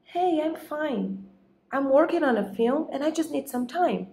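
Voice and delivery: high, softened voice for the husband's reply; lightly voiced husband making excuses; husband on phone.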